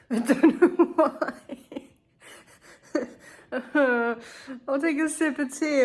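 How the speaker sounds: high, laughing bafflement; helpless laughing. Real, not acted. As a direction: laugh through the words. Laughing at puzzling pain.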